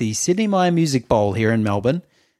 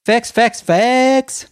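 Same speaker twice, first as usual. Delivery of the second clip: hyped host; 12 semitones higher, 4 dB louder, much slower than usual. Retold or imitated. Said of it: "Zayn goes".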